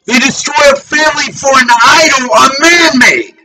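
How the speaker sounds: raging preaching. Real, not acted. Preacher rages at blasphemy.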